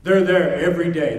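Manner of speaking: usual speaking voice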